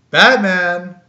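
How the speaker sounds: normal talking voice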